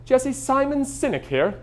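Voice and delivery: high, slow mock-British voice; British accent. Caller introducing himself on the phone, attempted British accent. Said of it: "my attempt at a British accent".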